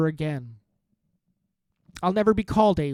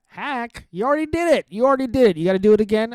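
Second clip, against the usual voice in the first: higher, louder jeering at a hack; mock heckle; 6 semitones higher, 6 dB louder, faster than usual. Comic jokingly yelling at Marc Maron, mock heckle. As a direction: heckle him like a rowdy crowd.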